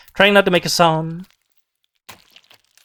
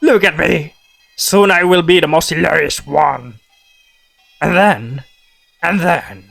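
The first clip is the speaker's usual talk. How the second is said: gurgling voice; slower than usual. Acted. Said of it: "how do I make a gargling sound?".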